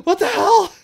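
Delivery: ordinary talk, nothing marked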